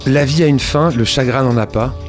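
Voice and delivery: ordinary talk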